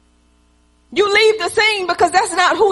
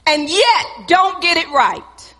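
usual speaking voice

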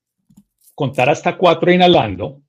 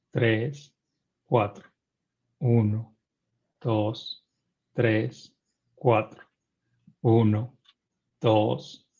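slow, soft, lower meditation count; guided breathing count; 4 semitones lower, 7 dB quieter, much slower than usual. Acted. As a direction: count each breath calmly and softly.

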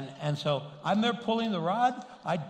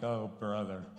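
imitated weary scorn, softer and lower; eye-rolling dismissal; 7 semitones lower, 5 dB quieter than usual. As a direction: mutter it low like a scoffing skeptic. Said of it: "he rolled his eyes like".

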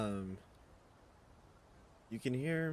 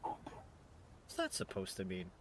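offended hush; 3 semitones lower, 7 dB quieter, much slower than usual. Acted. Friend takes offence.

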